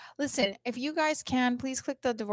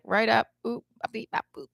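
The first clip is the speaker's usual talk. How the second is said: robotic beep-boop voice; 2 semitones higher, 5 dB louder than usual. Retold or imitated. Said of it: "He's like".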